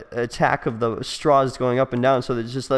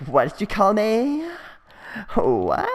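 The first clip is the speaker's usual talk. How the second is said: mock offended girl; 8 semitones higher than usual. Offended girls snapping back.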